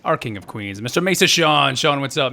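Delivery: ordinary talk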